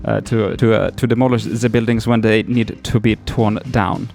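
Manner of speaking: put-on overdone Dutch-German accent, explaining his job; heavy accent. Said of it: "maybe I'm overdoing the accent".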